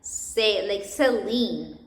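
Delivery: "ce" and Celine begun with an s sound; soft C. Shown as a demonstration. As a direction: draw out Celine with a soft s at the start. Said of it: "then C is soft".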